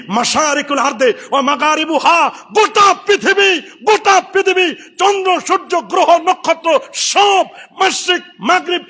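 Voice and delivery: ringing sermon delivery; impassioned preaching. Acted. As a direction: preach it out to the crowd. Preaches at full force.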